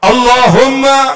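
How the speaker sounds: loud, slow, drawn-out Allahumma; full-voiced call. Shown as a demonstration. Preacher demonstrating a bold prayer, loud and slow. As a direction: let it ring out, fervent. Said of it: "kan begitu".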